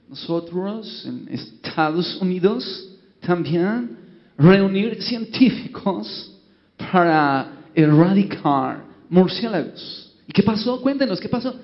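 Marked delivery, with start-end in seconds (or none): 0.1-10.1 put-on slow broken Spanish in an American accent; American bishop drawing out gringo-accented Spanish. American bishop reporting to the council, slow American accent. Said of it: "habló el obispo de Estados Unidos".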